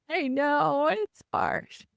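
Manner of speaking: hammed-up pouty agreement; mock whiny. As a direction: whine it like a wounded kid. Mock reply to the chatbot.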